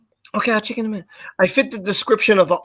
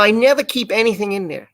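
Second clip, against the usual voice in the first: ordinary talk, nothing marked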